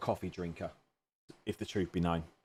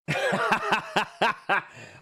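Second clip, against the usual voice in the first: high laugh; 11 semitones higher than usual. Real, not acted. Laughs at a chat comment.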